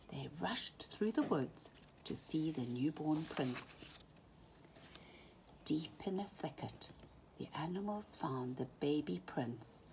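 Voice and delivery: hushed storyteller whisper. A narrator's reading. Hushed narration near the newborn.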